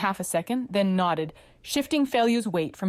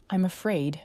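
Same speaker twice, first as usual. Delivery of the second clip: ordinary delivery, nothing marked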